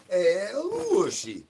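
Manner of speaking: slow high pet call; drawn-out call. Acted. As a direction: croon the name out slowly. Calling the pet again.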